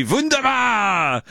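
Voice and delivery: high, drawn-out slogan shout; exuberant cry. Acted. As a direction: burst into a long, high candy-bar cry. Announces bar with the same cry.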